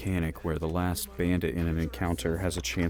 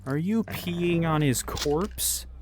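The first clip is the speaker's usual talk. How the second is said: amused disbelief; 10 semitones higher, much slower than usual. Real, not acted. Talking to the dog.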